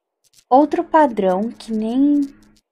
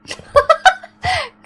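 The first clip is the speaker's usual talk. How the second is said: nervous laugh; 4 dB louder than usual. Real, not acted. Laughs after being caught.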